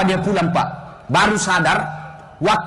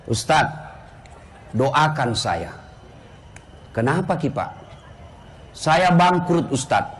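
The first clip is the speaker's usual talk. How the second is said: lower, softer pleading, a touch of tears; mock weeping plea; 8 semitones lower, 4 dB quieter, slower than usual. Bankrupt man begs the ustaz, low mild tearful plea. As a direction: beg for prayer like a broken man, understated. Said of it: "sambil menangis".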